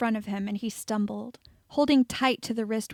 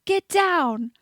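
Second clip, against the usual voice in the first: much higher and louder; urgent shout; 8 semitones higher, 8 dB louder than usual. A narrator's reading. Meyer warning everyone in the dark, shouting. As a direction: yell the warning.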